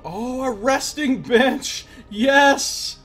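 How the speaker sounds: high, loud, drawn-out joy; excited. Real, not acted. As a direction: cheer it like finding water in a desert. Finds resting bench, delighted.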